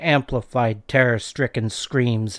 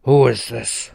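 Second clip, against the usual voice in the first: growled; 7 dB louder than usual. A narrator's reading. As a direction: growl it like a threat. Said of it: "the voice growled".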